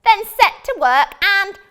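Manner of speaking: high, loud sneering wizard; mocking wizard. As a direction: taunt her like a gloating wizard. Wizard orders princess.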